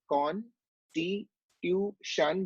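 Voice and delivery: "con, ti, tu, shun" as separate chopped syllables; scanning speech. Shown as a demonstration. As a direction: give every syllable its own effort. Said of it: "instead of you saying constitution as one word".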